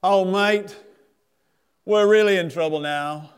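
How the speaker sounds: loud, high mock-worried sailor; sailor's punchline. Sailor tells his mate they are in trouble, hammed-up. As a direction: deliver the joke as a tipsy mate. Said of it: "he says".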